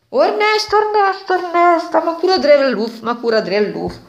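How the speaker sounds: high, loud alarmed calling of a name. Acted. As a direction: shout for help through the door. Neighbour calls Ernesto in alarm.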